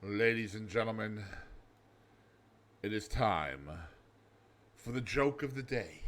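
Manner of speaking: soft, low joke-of-the-day intro; lightly hushed showman voice; hushed announcer. Acted.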